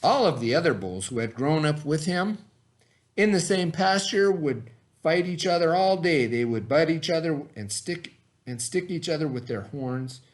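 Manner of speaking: put-on lively picture-book narration for young listeners; reading to children. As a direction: tell it like bedtime for a class of kids. Reads picture book to kids.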